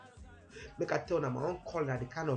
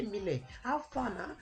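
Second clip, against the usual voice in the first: high, slow greeting; calling out; 7 semitones higher, slower than usual. Acted. Pastor rings Mile to scold him, high slow call.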